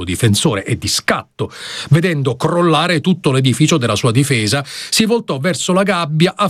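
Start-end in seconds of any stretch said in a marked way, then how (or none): none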